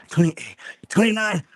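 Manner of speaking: straining on last reps. Real, not acted. Coach finishing a push-up set, counting out the last reps with strain.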